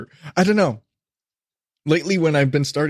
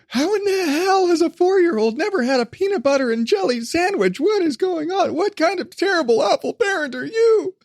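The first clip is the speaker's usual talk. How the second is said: much higher, outraged disbelief; shocked listeners; 14 semitones higher than usual. Retold or imitated. Imagined listeners shocked a four-year-old never had jelly, high outraged disbelief. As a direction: gasp in horror at a terrible parent. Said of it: "They're like".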